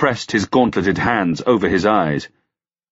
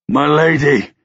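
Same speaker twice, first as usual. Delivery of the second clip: high, loud plea in despair; despairing cry; 7 semitones higher, 4 dB louder than usual. A narrator's reading. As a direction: cry out his lady's name, desperate. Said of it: "an exhalation of despair".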